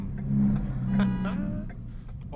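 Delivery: low, loud villain cackle; deep mock-sinister laugh; evil laugh. Evil laugh after the escape.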